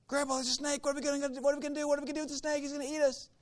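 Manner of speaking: put-on high, frantic child's voice, racing through the questions; panicked little boy. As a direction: squeal it like a scared kid begging grandpa. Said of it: "Boy freaks out".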